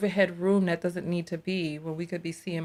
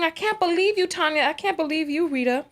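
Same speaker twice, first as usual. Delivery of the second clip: high-pitched, louder mock Rita voice; mimicking Rita; 9 semitones higher, 4 dB louder than usual. Host repeating Rita's words from the stream, high mock voice. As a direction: parrot her line back in a raised, mocking voice. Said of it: "Rita saying".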